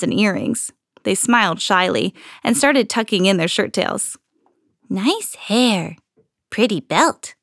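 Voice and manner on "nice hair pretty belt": shy troll compliment. A narrator's reading. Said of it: "they said, grinning".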